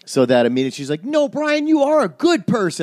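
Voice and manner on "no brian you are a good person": very high-pitched earnest mother; mock motherly reassurance, pitched way up; imitating his mom. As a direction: play a mom who takes the joke seriously. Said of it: "My mom has become my mom".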